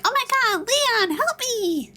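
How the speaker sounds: very high-pitched whiny damsel impression; annoying whining voice. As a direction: whine for help in a shrill, mocking squeal. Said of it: "that annoying whining voice".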